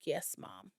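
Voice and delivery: meek compliance. Retold or imitated. Daughter giving in to mom's homework rule, imitated meek voice. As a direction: answer quietly like an obedient kid.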